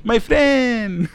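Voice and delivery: put-on comic thick Puerto Rican accent. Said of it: "He said "my friend" a lot".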